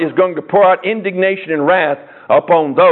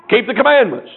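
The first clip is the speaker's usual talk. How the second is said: higher, punchy "Keep the commandments." as if amazed; incredulous repeat; 5 semitones higher than usual. Shown as a demonstration. Preacher repeats Jesus' strange answer to the congregation, amazed. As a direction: repeat it like you can't believe he said it. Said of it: "That's what Jesus said, right?".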